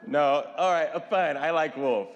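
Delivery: ordinary talk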